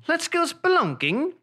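high, drawn-out mock British accent; slow, eager fake British call; hammed-up British adventurer. Back in mock British character.

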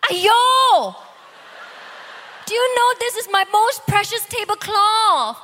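Aiyo, a mock furious wife scolding her husband; shrieking spouse. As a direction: shriek at the husband over the spilled wine. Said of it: "But if it's our spouse".

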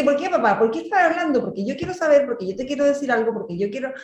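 half-mocking little girl nagging her dad; imitating a pestering child. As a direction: play the kid who won't stop asking why. Mother voices her questioning child.

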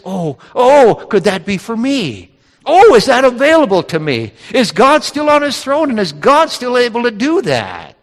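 high, eager wondering questions; excited hope. Acted. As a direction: burst out with rising excitement, as if amazed it's possible. Acts out hopeful reaction to promise.

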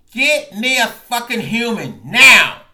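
a loud, high screech; screeching at the phone. Retold or imitated. Fed up with the AT&T robot, screeching for a human. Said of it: "I screeched into the phone".

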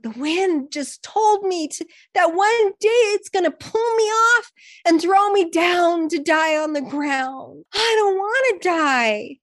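a frightened leaf afraid of falling; scared little leaf. Acted. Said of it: "And the leaf said".

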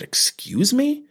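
drawn-out, high rising mock disbelief; affronted. Wife takes offence at his remark, affronted. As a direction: imitate someone taken aback, stretching the question upward.

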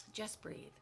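very soft, low breathing cue; calming. Real, not acted. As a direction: soothe them, hushed and low. Coaching listeners to breathe calmly.

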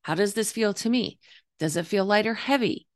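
normal talking voice

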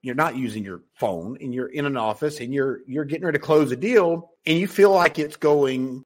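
usual speaking voice

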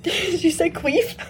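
giggling through the words; laughing so hard it squeaks. Real, not acted. Laughing fit after mishearing.

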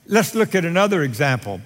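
normal talking voice

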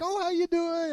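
high-pitched imitation of a friendly greeting; friendly lady. Taco place lady greets regular customer, friendly lady. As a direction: greet in a mock high, welcoming voice. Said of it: "the other lady likes me".

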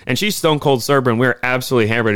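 ordinary delivery

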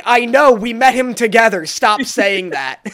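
loud, fed-up retort slowing at the end; mock-irritated, emphatic scolding; exasperated. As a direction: snap at a repetitive friend. Retells friend's exasperated reply.

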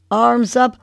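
ordinary talk

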